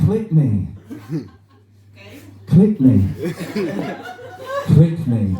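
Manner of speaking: hammed-up pop-up ad pleading to be clicked. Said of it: "appear like this and be like".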